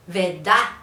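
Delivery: "Verdad" with no R, doubled D, final D dropped; costeño doubled D. Shown as a demonstration. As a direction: say Verdad as ved-dá, punched. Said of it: "quitan la R".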